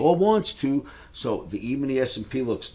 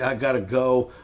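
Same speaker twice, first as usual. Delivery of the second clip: usual speaking voice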